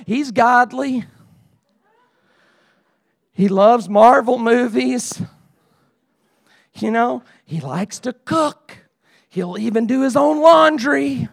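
lightly acted talking-up of a buddy; matchmaker's sales pitch. Preacher acting a matchmaker presenting his buddy, mild sales pitch. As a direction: list his virtues, pausing to let each land. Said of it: "anybody in here ever played matchmaker".

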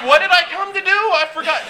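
high-pitched, acted confusion; mock bewilderment. Listener floored by Jesus forgetting their errand, mock bewilderment. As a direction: play it lost, pitched well up. Acting someone dazed after hearing Jesus.

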